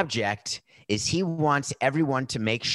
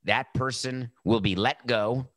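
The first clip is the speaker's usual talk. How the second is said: ordinary talk, nothing marked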